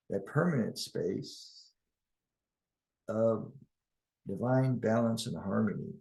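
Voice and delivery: calm, measured guiding voice with long pauses; guided meditation. Acted. Guide leading listeners into oneness and harmony, calm guided meditation. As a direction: lead them unhurriedly through the imagery.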